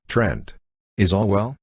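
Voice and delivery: mildly anxious call, rising into the question; understated worried check. A narrator's reading. Braith calls from the stairs below, a touch anxious. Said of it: "came Braith's anxious voice".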